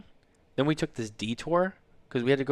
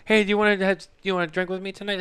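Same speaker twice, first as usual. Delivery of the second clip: high sing-song offer to drink; mock invite; 8 semitones higher, 4 dB louder, faster than usual. Husband mimicking relatives inviting wife to drink. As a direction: tease her with a high voice.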